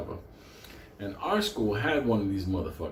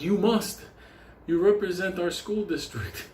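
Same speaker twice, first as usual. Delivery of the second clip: high, insistent teacher voice; a teacher pushing a scared kid, pitched up high; stern teacher; 8 semitones higher than usual. Retold or imitated. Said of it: "And the teacher like".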